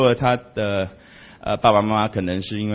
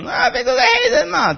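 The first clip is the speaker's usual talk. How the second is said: mock know-it-all. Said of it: "大家很清楚的就讲".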